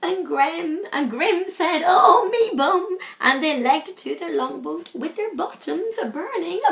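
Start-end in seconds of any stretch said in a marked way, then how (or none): 1.8-3.0 loud, high yelp of "Oh, me bum!"; pained yelp. Acted. Grim crying out as his bottom burns, loud. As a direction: cry out with a burning bottom.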